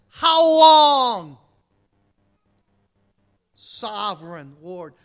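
crying out loud to God. Acted. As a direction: call out to heaven in anguish. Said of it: "they cried out in a loud voice".

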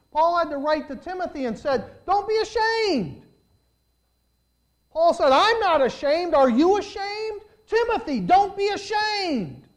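loud, high exhortation with stretched key words; full-force preaching. Real, not acted. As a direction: preach it as a ringing challenge to the congregation.

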